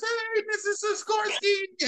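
high, drawn-out singing phone greeting; mock the professional voice, slow and very high; sing-song work greeting. Man mocking his wife's work talk, high sing-song. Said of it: "I don't go to singing the words".

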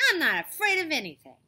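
high, loud protest; indignant boast. A narrator's reading. Tiffany answers whether she fears exploring, indignant. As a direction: protest bravely at full voice. Tiffany challenged about being afraid.

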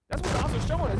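loud, high preaching cry; emphatic preaching. Real, not acted. Preacher shouting his point at the crowd, loud and high. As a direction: call it out to the crowd.

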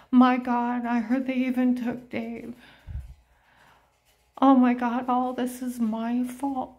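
a retold sobbing plea that keeps rising; wailing apology. Imitating a guilty man wailing his apology down the street. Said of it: "crying his eyes out, voice shaking".

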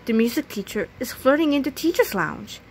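plain reading voice

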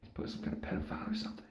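hushed, breathy coworker gossip, rushing at the end; gossiping whisper. Retold or imitated. Coworkers whispering about the guy who watches TV, hushed. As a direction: whisper it behind his back. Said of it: "it's like".

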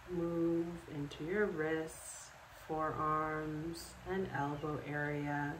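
calm, unhurried guided relaxation with long pauses; guided meditation. Acted. Yoga instructor guiding listeners to sleep in bed, calm meditation voice. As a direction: lead them through it step by step, leaving space after each instruction.

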